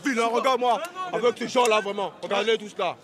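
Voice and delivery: screaming insults. Acted. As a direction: scream abuse at him. Heated insult shouting match.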